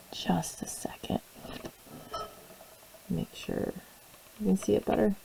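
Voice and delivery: whispering. Real, not acted. Mutters aside while focusing camera.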